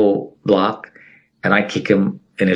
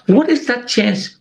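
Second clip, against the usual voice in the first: amazed question; 12 semitones higher, slower than usual. Real, not acted. Marvelling at a coincidence.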